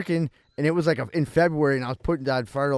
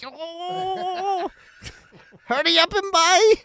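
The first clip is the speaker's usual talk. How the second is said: squeaky mock Chinese shopkeeper, much higher; 14 semitones higher, much slower than usual. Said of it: "racist impressions".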